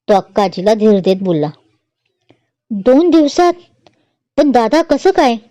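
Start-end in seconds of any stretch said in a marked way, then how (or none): 2.7-5.4 put-on higher, puzzled young sister; innocent surprise. A narrator's reading. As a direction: ask it wide-eyed and naive. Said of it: "सोनमचा भाबडा सवाल". (भोळा आश्चर्याचा प्रश्न)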